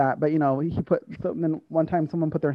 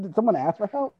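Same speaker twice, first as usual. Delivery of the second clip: ordinary talk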